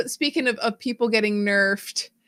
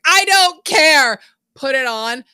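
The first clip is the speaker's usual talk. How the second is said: defiant character voice; 4 semitones higher, 8 dB louder, slower than usual. Retold or imitated. Character voiced putting on cursed hat.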